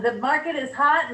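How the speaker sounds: usual speaking voice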